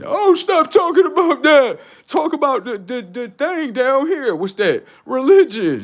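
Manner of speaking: high, slow, mocking voice; a hammed-up plea to change the subject; mock whiny critic. Voicing critics telling him stop.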